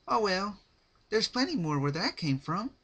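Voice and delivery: high and loud, bright and ominous; cheery Pinkie. Acted.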